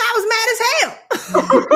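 high, drawn-out admission with a laugh; emphatic retort. Retold or imitated. Telling the lady about her career, high emphatic retort. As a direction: own up loudly and slowly, amused.

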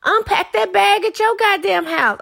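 high, loud exasperated yelling; angry rant. Acted. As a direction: tell them off, fed up and shouting. Ranting at a freeloading partner.

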